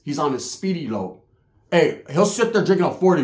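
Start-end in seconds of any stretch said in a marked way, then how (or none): none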